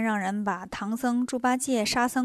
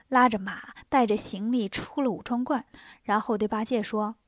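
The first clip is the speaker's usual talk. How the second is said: usual speaking voice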